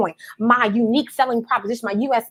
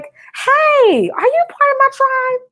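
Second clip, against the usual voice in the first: much higher, louder, bright call out; excited invite; 4 dB louder, much slower than usual. Shown as a demonstration. Coach demoing a hyped greeting to a prospect, much higher. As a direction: call out to a friend, thrilled. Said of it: "You gotta say things like".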